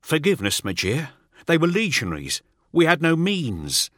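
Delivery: high, anxious report; nervous underling. Acted. Teth reporting his losses to Null, high and anxious. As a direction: rush out the bad news. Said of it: "He pauses".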